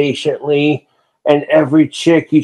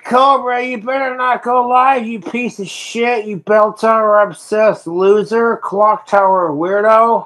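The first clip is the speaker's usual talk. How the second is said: much higher, mocking sing-song insults; mock troll taunt; 7 semitones higher than usual. Streamer imitating trolls insulting him, mocking high taunt. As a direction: sneer it like a jeering troll.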